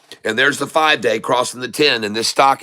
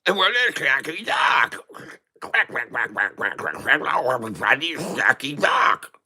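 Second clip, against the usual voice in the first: cartoon duck voice; 4 dB quieter than usual. Vlogger hamming a duck mascot for viewers, cartoon duck voice. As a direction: ham it up as a silly cartoon duck.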